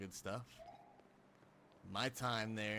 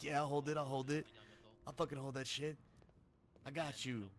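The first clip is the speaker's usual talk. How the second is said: lightly acted, pitched-up eagerness; quick to volunteer, a bit raised; eager mock offer; 6 semitones higher, 3 dB louder than usual. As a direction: play the over-willing helper. Acts out a too-nice offer.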